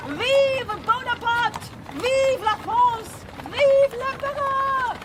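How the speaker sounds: slow, high triumphant cry; defiant cheer. Acted. Becky cheering her escape from school, slow and triumphant. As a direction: shout it like a victory toast.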